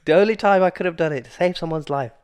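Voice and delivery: loud, exasperated mock cry; frustrated outburst. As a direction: blurt it out in frustration.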